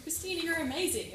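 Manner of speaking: high gushing compliment; gushing praise. Retold or imitated. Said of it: "gushing at me".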